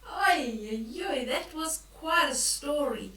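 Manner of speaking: plain reading voice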